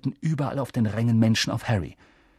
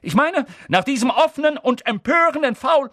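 excited commentator; 12 semitones higher, 5 dB louder than usual. Acted.

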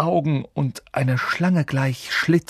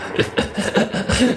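high, breathy evil cackle; a cruel, gloating laugh; 7 semitones higher than usual. A narrator's reading. Voldemort laughs at the death of Harry's parents, an evil cackle.

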